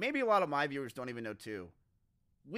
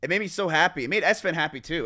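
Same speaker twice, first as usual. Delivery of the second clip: excited; 3 semitones higher, 9 dB louder than usual. Real, not acted. Excited about good times.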